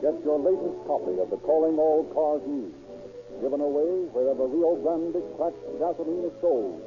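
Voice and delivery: ordinary talk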